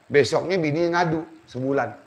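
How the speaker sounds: usual speaking voice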